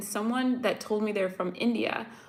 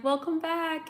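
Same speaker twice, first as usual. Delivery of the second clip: high, sing-song cashier welcome; mock friendly store clerk greeting; chirpy shop greeting; 8 semitones higher than usual. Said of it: "people are like".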